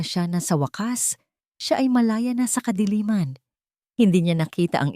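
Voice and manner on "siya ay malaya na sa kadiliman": ordinary delivery, nothing marked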